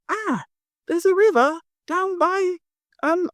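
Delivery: elf voice. Acted. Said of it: "elf, goes".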